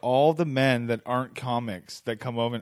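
usual speaking voice

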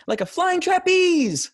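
high, loud, slow elf exclamation. Retold or imitated. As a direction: belt it out like a cheery elf. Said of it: "the little exclamations and stuff".

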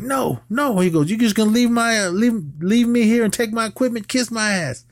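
high-pitched offended whine; indignant high protest. Retold or imitated. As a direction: whine it out like a wronged diva. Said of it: "Bilal's like".

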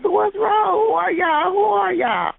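high, frantic questions; begging to know what's happening; panicked plea. Retold or imitated. Woman woken by police, pleading high and frantic.

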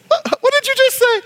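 high, shocked double-take question; a teacher shocked by what she heard; startled what. Retold or imitated. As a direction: react in disbelief. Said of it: "I said".

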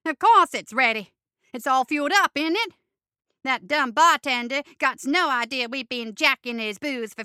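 loud, gleeful gremlin gloating over his plan; malicious cackling goblin. Acted. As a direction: crow it out, loud and scheming. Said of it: "He cackled maliciously".

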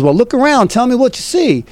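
mock helpful pal giving orders; imitating Don. Retelling Don giving directions.

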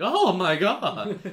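high, slow, loud dopey gasp; goofy overwhelmed sheriff. Retold or imitated. Podcaster mimics overwhelmed sheriff in movie, goofy high drawn-out gasp. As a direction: play a befuddled small-town cop caught off guard. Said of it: "like, Chief Wiggum-y".

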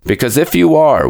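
high, querulous complaint; querulous old man. A narrator's reading. Old man addresses his supposed father, querulously. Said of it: "went on the old man querulously".

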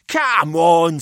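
put-on a wry businessman weighing up the boys; Pelham's voice.

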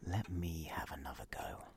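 hushed conspiratorial whisper. Acted. Whispered plotting beside the girl.

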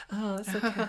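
high, put-on polite reassurance; pretend laughing. Staff answering a star's apology, mock awkward politeness. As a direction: mimic awkward staff being over-nice. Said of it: "like, pretend laugh".

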